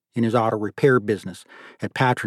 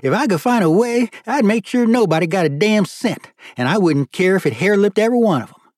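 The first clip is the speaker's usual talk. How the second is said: high, loud Southern character voice; high-pitched drawling old-money grumble; riled judge; 9 semitones higher, 4 dB louder than usual. Acted. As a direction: play a folksy judge venting, pitched well up.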